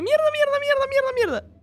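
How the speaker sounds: high, frantic, repeated cursing; panicked swearing. Retold or imitated. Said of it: "se levanta corriendo y dice".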